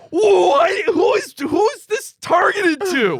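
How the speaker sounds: high, loud, disbelieving shout; outraged shout. Retold or imitated. Baffled by the ad, shouting it at his co-host. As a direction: yell it out like you can't believe the ad.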